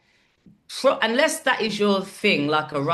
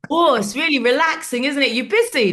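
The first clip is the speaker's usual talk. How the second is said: high, loud, breezy; cheery casual ask; 9 semitones higher, 6 dB louder than usual. Retold or imitated. James casually asking the comic if she's free, high and breezy. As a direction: sound offhand while fishing.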